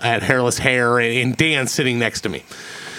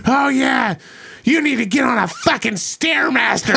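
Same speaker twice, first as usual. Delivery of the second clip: mock sneering jab, much higher; angry woman taunting him about his weight, higher mock sneer; retold insult; 10 semitones higher than usual. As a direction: imitate her mocking taunt, pitched up. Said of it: "she finally was just like".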